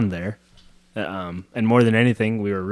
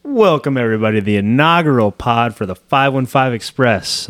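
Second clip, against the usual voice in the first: podcast host intro; 2 semitones higher, 5 dB louder than usual. Acted. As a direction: hype up the listeners.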